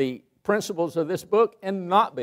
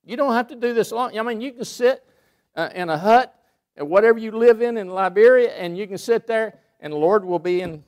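higher and louder, pressing each phrase home; fervent preaching; 7 semitones higher, 5 dB louder than usual. Acted. Preacher urging Kyle going to Liberia, fervent preaching. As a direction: preach it, build the promise up.

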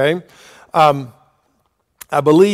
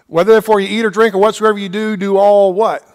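quick run-through of the verse, pitched higher; brisk correction; 3 semitones higher than usual. Shown as a demonstration. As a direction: rattle it off and leave the ending open. Corrects the misquote, prompting the listeners.

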